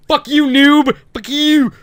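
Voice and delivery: high loud trash-talk yell; gamer yelling. Retold or imitated. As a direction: scream it at the zombies. Yelling at game zombies.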